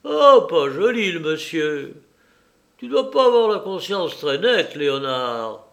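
high, mocking taunt; gloating, derisive teasing; sneering. A narrator's reading. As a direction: jeer at the captive with exaggerated pitch. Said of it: "éclaira le visage du domestique et ricana".

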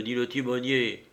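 plain reading voice

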